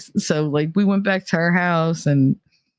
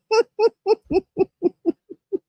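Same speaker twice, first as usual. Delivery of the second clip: high burst of laughter. Real, not acted. Reacting to the carrot oil story, a high laugh.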